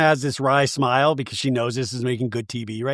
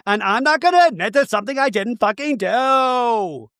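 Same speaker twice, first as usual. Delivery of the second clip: mock yelling denial; 7 semitones higher than usual. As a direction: yell a denial, hammed-up, stretching the end. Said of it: "the clapping and the yelling".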